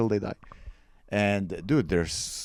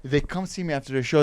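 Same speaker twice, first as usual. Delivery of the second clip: ordinary talk, nothing marked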